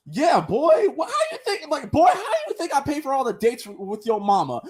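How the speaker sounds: high-pitched, laughing dad retort; mock dad. Dad answers his son about paying for dates, incredulous mock scolding. Voices the dad in retold scene.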